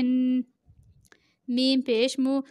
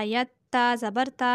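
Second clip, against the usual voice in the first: ordinary talk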